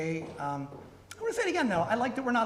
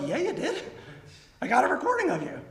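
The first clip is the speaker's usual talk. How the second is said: mock smug bank; 6 semitones higher than usual. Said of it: "They're like".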